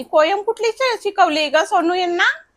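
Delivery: high, loud household question; calling out. Real, not acted. Mother calling to Sonu about the child's poem, high and loud. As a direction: raise the voice high to reach the others.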